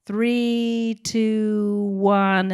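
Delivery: long, held, level notes on each number; on-set countdown. Retold or imitated. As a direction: count down slowly to ease them into it. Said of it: "on set, I would say".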